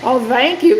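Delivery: ordinary talk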